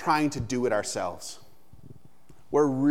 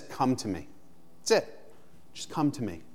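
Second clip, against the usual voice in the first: gentle, softer "Come to me"; soft invitation; 7 dB quieter than usual. Shown as a demonstration. Pastor voicing Jesus' call to the weary, soft and simple. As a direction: say it simply and softly, an open invitation. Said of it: "He just says".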